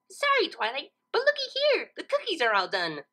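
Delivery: high, bubbly pony voice; Pinkie voice. Acted. Pinkie apologises to Twilight and shows the cookies. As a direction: chirp it cheerfully.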